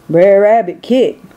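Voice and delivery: ordinary delivery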